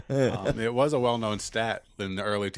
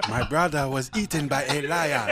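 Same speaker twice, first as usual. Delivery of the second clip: hammed-up African accent on the edge of laughter; mock African accent. Joking to friends about a brother, mock African accent. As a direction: put on a comic African accent. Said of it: "See, there's the accent".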